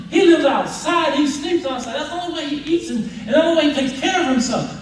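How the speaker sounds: high, loud indignant shouting; outraged student. Acted. Student shouts at the rich sheikh, outraged. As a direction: shout him down in disbelief.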